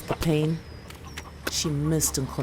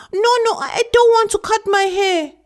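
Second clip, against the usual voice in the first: put-on very high, loud little girl pleading; protesting child; 9 dB louder than usual. Girl protesting to grandmother about her haircut. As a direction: beg like a small child.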